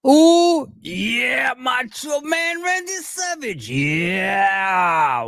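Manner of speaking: high, loud gravelly wrestler promo yell; Randy Savage impression. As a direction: shout it like a hyped wrestler cutting a promo.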